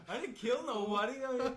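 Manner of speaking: loud and high, laughing it off. Real, not acted. Laughing to his cousin that he killed nobody, laughing loud. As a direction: protest while cracking up.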